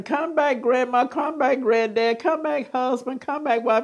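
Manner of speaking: high, pleading voice calling the dead back. Retold or imitated. Grieving family calling the dead.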